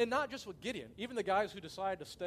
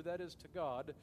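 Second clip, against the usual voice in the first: ordinary talk, nothing marked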